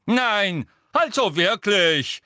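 high, loud, fussy dismay; flustered examiner tutting aloud; worried professor. A narrator's reading. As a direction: protest slowly, pitched well up. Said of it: "sagte Professor Tofty besorgt".

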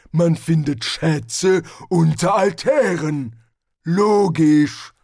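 slow, loud, lumbering barbarian voice; a big brute savouring his words; Hrun, the barbarian. Acted. Barbarian explains his logic.

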